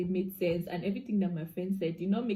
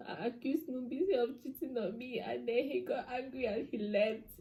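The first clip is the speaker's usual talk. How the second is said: higher, softer, mock-tearful voice; retold tearful confession; 4 semitones higher, 6 dB quieter than usual. Crying woman explaining to best friend, imitated tearfulness. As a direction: imitate your upset self, small and high. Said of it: "I was crying".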